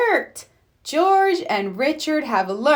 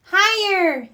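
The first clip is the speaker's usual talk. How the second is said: little piglet voice; 9 semitones higher than usual. Acted. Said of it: "says George".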